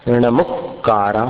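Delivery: नमुक्कारा said slowly with a short उ after म. Shown as a demonstration. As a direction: stretch नमुक्कारा, keeping the म में उ short. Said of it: "म में ह्रस्व उ की मात्रा". (नमुक्कारा धीरे, म पर ह्रस्व उ के साथ)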